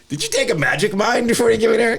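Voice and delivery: high mock voice. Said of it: "they're like".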